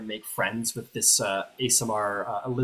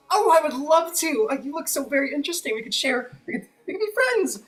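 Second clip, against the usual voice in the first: very high, gushing, racing faster; delighted Elizabeth Stonesworn; 3 dB louder, faster than usual. Acted. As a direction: bubble over with eagerness to make a friend. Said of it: "She goes".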